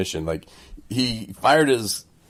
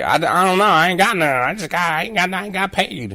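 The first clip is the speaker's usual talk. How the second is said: much higher, stammering mock voice; flustered defendant; 12 semitones higher, 4 dB louder than usual. Host imitating a confused woman stammering before the judge, much higher. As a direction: play the lost woman fumbling her words.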